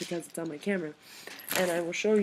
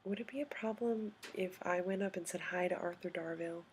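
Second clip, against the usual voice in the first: small, hushed innocent request; soft, breath-light plea acting innocent; innocent, timid; 10 dB quieter than usual. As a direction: ask meekly, almost whispering. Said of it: "looked at him really innocently".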